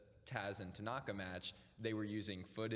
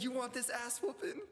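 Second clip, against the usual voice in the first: put-on New York accent; 13 semitones higher than usual. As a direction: taunt like a tough New Yorker. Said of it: "He's like".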